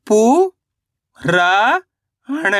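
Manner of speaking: पू and रा long and rising, अण short; sound by sound. Shown as a demonstration. Teacher breaking a word down for learners, syllable by syllable. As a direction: sound out पू ... रा ... अण with pauses. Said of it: "तो मिलके बन जाएगा".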